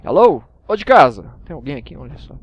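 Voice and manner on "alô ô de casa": loud calling hello into the dark. Real, not acted. Gamer at a giant dungeon door, calling out loudly. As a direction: call out to whoever is inside.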